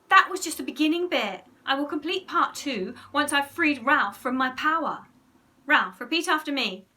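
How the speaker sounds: boastful instruction to a subject; bluffing command. A narrator's reading. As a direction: insist on your powers. Henry bluffs as masterful hypnotist.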